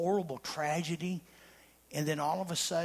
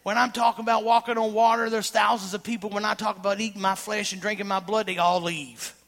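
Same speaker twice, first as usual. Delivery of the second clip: preaching; 5 semitones higher, 7 dB louder than usual. Acted. Preaching continues loud.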